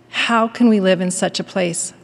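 usual speaking voice

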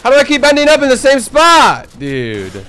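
high, loud frustrated yell; exasperated yell. Real, not acted. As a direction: yell it in disbelief.

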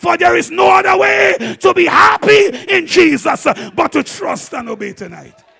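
loud, fired-up sermon voice; full-force preaching. Acted. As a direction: preach it to the house at full volume.

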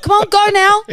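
high, loud impatient hurry-up; impatient urging. Retold or imitated. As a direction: urge the kids along, fed up. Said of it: "and then you're like".

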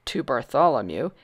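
ordinary delivery, nothing marked

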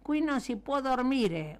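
storybook shout from a sleepless neighbor; yelled complaint. A narrator's reading. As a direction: yell down at the noisy men. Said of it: "urlò un signore affacciandosi".

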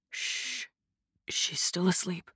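very soft near-whisper; hushed. A narrator's reading. Kai shushing a friend while she sleeps, hushed. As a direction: hush someone beside a sleeper. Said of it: "said in a hushed tone".

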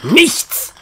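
high, exasperated cry; frustrated outburst. Acted. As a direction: vent the frustration in a single word. Frustrated after hours of searching. (frustrierter Ausruf)

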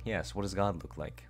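breathy creepy whisper; horror whisper. Pretend. Repeating the game's question, whispered and eerie. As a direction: whisper it like a ghost. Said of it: "horror maxing".

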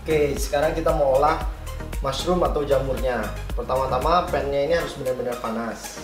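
ordinary talk

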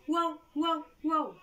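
high, loud woof woof; playful dog sound for kids; dog barking. Acted. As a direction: bark like a friendly puppy. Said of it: "El perro dice así".

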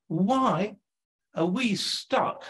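ordinary talk, nothing marked

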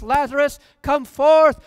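high, loud call, come forth loudest; loud cry. Acted. Jesus calling Lazarus out of the tomb, loud cry. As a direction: call the dead man out of the tomb. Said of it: "he cried out with a loud voice".

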